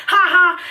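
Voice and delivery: high mock "ha ha"; imitated laughter. Mom delighted by pregnancy news, mimicked high laugh. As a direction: mimic someone busting up laughing. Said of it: "busting up laughing".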